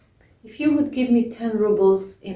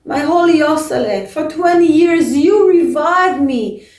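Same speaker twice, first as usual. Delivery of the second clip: loud, higher wailing cry to the dead; screaming plea; 7 semitones higher, 8 dB louder than usual. Acted. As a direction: cry out his name with the whole congregation. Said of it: "Everyone was screaming in such a loud voice".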